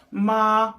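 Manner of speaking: MA held long on a high pitch; attempted high tone. Shown as a demonstration. Retrying a tone demonstration, long high MA. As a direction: stretch "ma" out high and level. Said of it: "I can't demonstrate it so well".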